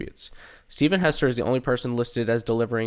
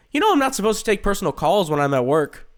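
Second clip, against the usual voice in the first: playful scolding; 8 semitones higher, 3 dB louder than usual. Acted. Friend scolding on phone.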